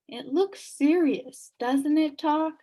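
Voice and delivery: put-on slow, sad boy's question; very sadly. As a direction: let the worry weigh on it. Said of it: "said Milo very sadly".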